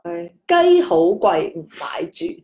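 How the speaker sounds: 鸡好贵 唔买住 read slowly in its Cantonese tones, the end dropping low; slow example sentence; slow demo. Shown as a demonstration. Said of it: "所以这个读起来就是".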